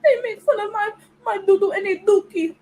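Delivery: high, whiny fake sobbing complaint; mock crying. Mocking Africans who complain about being teased, fake crying. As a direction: blubber it like a crybaby to mock them. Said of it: "y'all will sit here crying and complaining".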